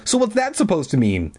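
high, challenging retort; crying out. A narrator's reading. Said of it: "I cried".

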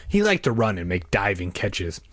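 ordinary delivery, nothing marked

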